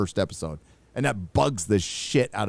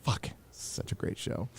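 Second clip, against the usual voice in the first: whisper; 14 dB quieter than usual. Real, not acted. Awed aside.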